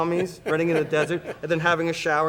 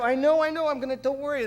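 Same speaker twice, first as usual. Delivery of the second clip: high reassuring; 10 semitones higher than usual. Retold or imitated. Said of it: "I go".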